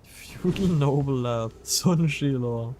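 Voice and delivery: normal talking voice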